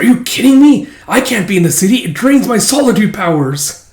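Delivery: high, loud protest; mock indignation. Hemlock refusing to work in the city, mock indignant. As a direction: complain in hammed-up outrage.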